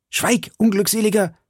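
high, urgent command to be silent; urgent call. A narrator's reading. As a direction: call out sharply to hush him. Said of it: "rief der junge Mann".

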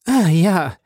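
high, bright "Ah ja"; light up with delight; beaming again. A narrator's reading. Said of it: "jetzt strahlte das Gesichtchen wieder".

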